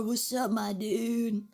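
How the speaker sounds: chill guy voice. Pretend. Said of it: "that's what he sounds like".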